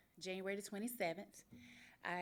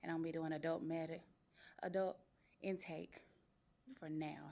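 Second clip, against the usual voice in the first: normal talking voice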